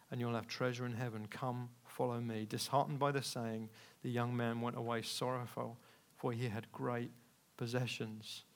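soft, low, solemn reading; hushed scripture reading. Real, not acted. Preacher reading of Jesus and the rich young man, hushed. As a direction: let the voice drop gently for the verse.

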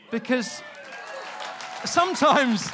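loud, high, impassioned exhortation; full-force preaching. Real, not acted. Preacher urging people to kick against the silencing voice, impassioned.

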